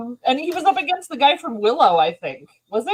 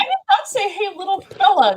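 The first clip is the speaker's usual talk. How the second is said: high protest; 10 semitones higher than usual. Real, not acted. Protesting denial, laughing.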